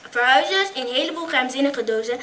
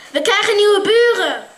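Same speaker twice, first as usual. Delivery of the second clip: excited announcement; 6 semitones higher, 11 dB louder than usual. Acted. Excited news to mom.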